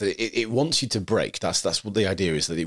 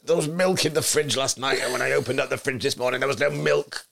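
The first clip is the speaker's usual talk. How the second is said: higher-pitched, wound-up complaint; exasperated mock rant; 6 semitones higher than usual. Podcaster acting out a misfortune over milk, hammed-up exasperation.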